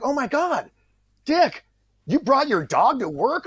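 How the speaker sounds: high-pitched teasing disbelief; mock astonishment. Riffing mock shock at dog.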